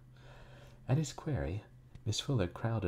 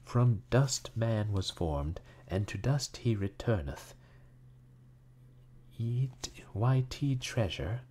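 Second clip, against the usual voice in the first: slow, raised, laboured recitation; reading slowly; 5 semitones higher, 5 dB louder, slower than usual. A narrator's reading. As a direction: read it out slowly, word by word. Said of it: "went on reading slowly".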